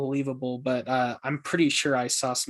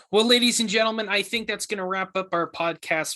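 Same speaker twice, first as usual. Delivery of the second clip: announcer sign-off; 6 semitones higher than usual. Acted. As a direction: address the audience like a host. Host starts wrapping up the podcast.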